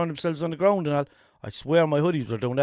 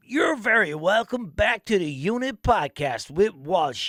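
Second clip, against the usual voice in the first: slow, raised dramatic intro voice; mock announcer; 5 semitones higher, slower than usual. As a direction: ham it up like a TV narrator.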